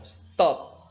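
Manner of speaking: "Stop" punched out loud as one syllable; "Stop" as a single forceful beat; strong stressed syllable. Shown as a demonstration. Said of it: "a sílaba é forte. Ó".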